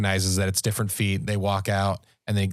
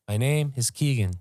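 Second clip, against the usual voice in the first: eerie ghost recording voice; 3 semitones higher than usual. Retold or imitated. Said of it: "it's what it sounded like".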